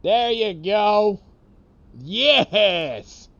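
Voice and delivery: drawn-out, high, loud cheer; excited cheer. Real, not acted. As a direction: celebrate the shot out loud. Player cheering a great shot.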